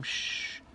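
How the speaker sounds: a soft unvoiced shh; a light mock shushing sound; imitated hushing. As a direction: hush like someone talking low. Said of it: "talking really fast and really, really low".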